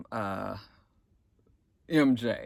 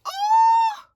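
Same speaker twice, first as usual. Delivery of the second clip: very high, exaggerated exclamation; hammed-up shock, pitched sky high; shocked reaction; 3 dB louder than usual. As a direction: let out a scandalized high "oh".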